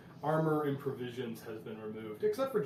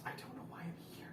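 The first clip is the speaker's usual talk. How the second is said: softer, breathless whisper; scared whisper; 4 semitones higher, 11 dB quieter, faster than usual. Acted. Prisoner Lorelei whispers her fear to the party. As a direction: whisper it like you're lost and afraid.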